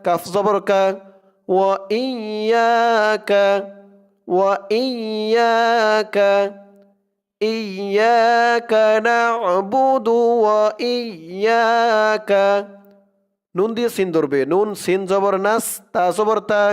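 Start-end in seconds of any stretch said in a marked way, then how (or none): 1.5-12.5 slow tajweed chant, vowels held long; Quran recitation. Shown as a demonstration. Teacher modelling the verse for learners, slow Quran recitation. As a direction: recite it slowly and measured, syllables drawn out.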